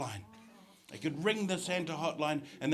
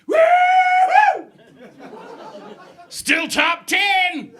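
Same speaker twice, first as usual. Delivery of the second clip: cheering shout; 13 dB louder, much slower than usual. Retold or imitated. Audience member cheering.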